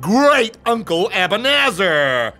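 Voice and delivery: booming, high-pitched showman uncle; Abanazar. Acted. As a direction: announce yourself loudly and grandly, stretching the words. Voices great-uncle Abanazar introducing himself.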